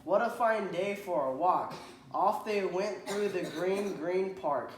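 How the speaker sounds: put-on bright, lively read-aloud to a child; cheerful storybook narration. A narrator's reading. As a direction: read it like a picture book for a kid. Reads picture book aloud.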